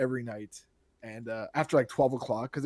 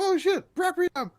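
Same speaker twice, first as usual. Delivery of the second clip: very high, louder mock amazement; excited; 4 dB louder, slower than usual. Podcaster mimicking friend seeing the game, excited. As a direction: squeal it like a thrilled unboxing. Imagining friend opening the box.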